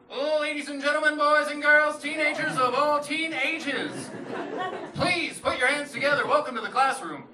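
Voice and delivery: high, loud ring-announcer voice; hammed-up arena announcer; grand announcer. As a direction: introduce him like a boxing champ. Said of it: "stood in the hallway and just announced himself".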